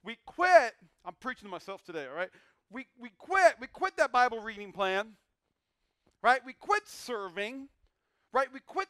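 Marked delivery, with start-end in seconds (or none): 0.0-0.7 preacher's shout, loud and high. Real, not acted. 2.7-8.9 loud, high preaching shout. Real, not acted.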